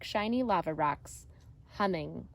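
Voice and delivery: plain reading voice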